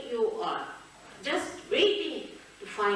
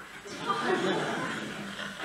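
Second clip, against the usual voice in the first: amused laugh; 10 semitones higher than usual. Real, not acted. Laughing at the story.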